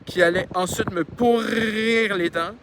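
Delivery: "pourrir" stretched out very long; drawn-out word. A narrator's reading. Reader follows the spelling on the page, stretching "pourrir". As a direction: drag out the middle of "pourrir". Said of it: "Quand je dis des trucs plus longs, c'est que c'est écrit comme ça".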